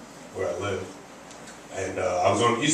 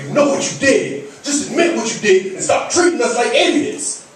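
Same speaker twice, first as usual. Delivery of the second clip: high, loud, accusing courtroom attack; dramatic mock cross-examination; interrogating prosecutor; 13 semitones higher, 5 dB louder than usual. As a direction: hammer the witness as a fired-up TV prosecutor. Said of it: "he was interrogating a witness on the stand".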